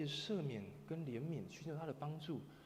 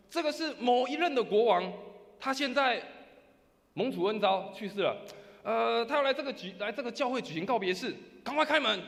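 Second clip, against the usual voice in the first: shouted royal announcement, higher and louder; loud proclamation; 9 semitones higher, 11 dB louder than usual. Retold or imitated. Leader loudly proclaiming the king at the door. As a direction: announce the dead king grandly. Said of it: "再大声喊说".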